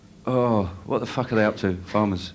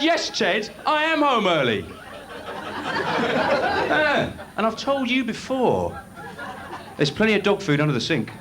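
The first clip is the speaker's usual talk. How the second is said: telling off the dog; 9 semitones higher than usual. Pretend. Comic tells off his dog.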